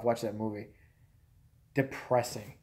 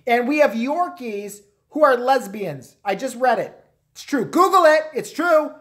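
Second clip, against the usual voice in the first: high, hammed-up gossip building louder; mock insistence; 7 semitones higher, 5 dB louder than usual. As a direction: insist louder and higher as a pretend alarmist. Acting a gullible news-feed alarmist.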